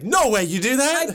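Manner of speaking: high, loud, drawn-out disbelief; mock shock. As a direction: act floored, pitch shooting up. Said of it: "I'm, like, shocked".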